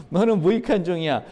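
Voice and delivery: said through a laugh; laughing verdict. Acted. Jesus telling the servant again, now chuckling. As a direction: laugh while you hand down the verdict.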